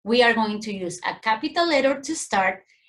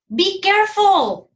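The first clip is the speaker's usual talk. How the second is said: much higher and louder, urgent call; urgent warning; 9 semitones higher, 7 dB louder than usual. Shown as a demonstration. Teacher demonstrating a warning exclamation, loud urgent call. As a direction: warn them sharply, as if danger is near. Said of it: "These sentences express emotions".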